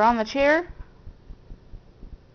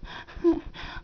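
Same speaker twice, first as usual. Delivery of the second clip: warm mom laugh. Real, not acted. Dog has the hiccups.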